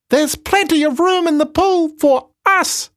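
very high, cheeky monkey; squeaky monkey voice. Acted. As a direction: play a tiny chattering monkey. Monkey speaks in the story.

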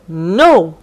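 loud, flat refusal; firm no. A narrator's reading. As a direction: say no firmly. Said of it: "said the captain".

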